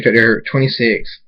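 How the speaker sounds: plain reading voice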